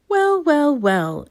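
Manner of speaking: high, surprised wizard exclamation; a wizard's delighted well, well, well; exclaimed. Acted. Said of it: "exclaimed the wizard".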